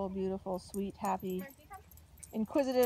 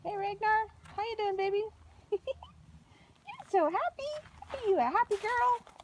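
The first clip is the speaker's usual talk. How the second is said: higher, drawn-out sing-song coo with a laugh; 7 semitones higher than usual. Real, not acted. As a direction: gush sweetly over a happy pup. Cooing to puppy Ragnar.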